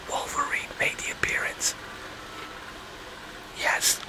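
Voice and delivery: whispering a spoiler to the viewers. Real, not acted. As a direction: whisper "Wolverine made the appearance" very softly.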